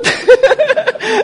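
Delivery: a sudden high laugh breaking out; high burst of laughter. Real, not acted. Preacher laughing at his wife's remark, high burst of laughter. Congregation joke, big laugh.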